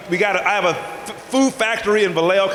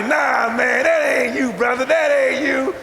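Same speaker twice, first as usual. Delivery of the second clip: janitor disbelief; 4 semitones higher than usual. Retold or imitated. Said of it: "He was like".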